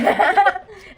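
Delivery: loud happy laugh; burst of laughter. Real, not acted. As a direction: break into real laughter. Laughing at sister's joke.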